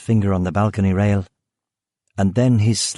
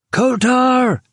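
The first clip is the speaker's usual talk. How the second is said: high, shouted name; screamed; 3 dB louder than usual. A narrator's reading. Killian calling the hiding man, high scream. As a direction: scream the name across the garden. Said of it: "Killian screamed".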